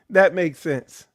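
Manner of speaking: ordinary delivery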